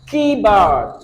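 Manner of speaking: teacher's demo of "Keyboard", first part stressed; stress on KEY. Shown as a demonstration. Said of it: "stress key".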